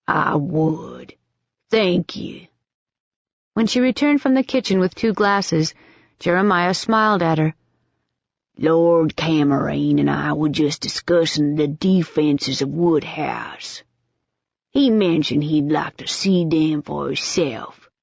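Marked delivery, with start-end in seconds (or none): 0.1-2.5 put-on old Southern accent. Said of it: "His accent was the old Southern". 8.6-17.9 put-on old Southern accent. Jeremiah's Southern voice.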